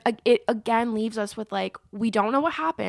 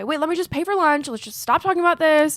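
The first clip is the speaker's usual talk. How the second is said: higher, hurried-to-drop-it quote; acted brush-off; 5 semitones higher, 6 dB louder than usual. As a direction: play someone desperate to smooth it over. Acted brush-off to avoid confrontation.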